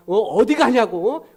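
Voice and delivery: a hammed-up where are you going; mock yell. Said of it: "사생 결단하지 마세요". (아내 흉내로 크게 따지듯)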